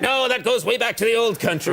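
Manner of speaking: lightly voiced mother, a touch of high pitch; the mother insisting the phrase is old Irish; mock mother. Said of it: "would be like".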